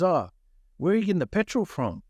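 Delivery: high-pitched, pointed mock interrogation; probing question. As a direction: imitate a stepdad fishing for an answer. Stepdad's pointed questioning, acted out.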